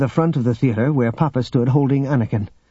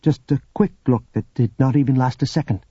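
ordinary delivery

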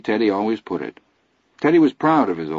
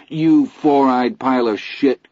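louder, slow exasperated outburst; cry out; 5 semitones higher, 4 dB louder, slower than usual. Acted. Chris yelling at Teddy over cards, slow outburst. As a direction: spit it out at him, slow. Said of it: "Chris cried".

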